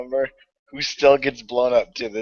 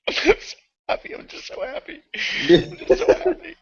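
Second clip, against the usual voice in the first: laughing; 12 semitones higher than usual. Real, not acted. Giddy after the win.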